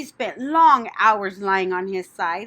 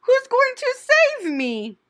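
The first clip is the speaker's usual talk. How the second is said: desperate pleading; 6 dB louder, slower than usual. A narrator's reading. Wilbur pleading in terror.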